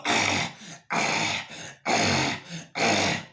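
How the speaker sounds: short, strained panting gasps with little voice; imitated dog wheezing. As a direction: mimic a dog fighting for air. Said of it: "can't hear that dog breathing like that".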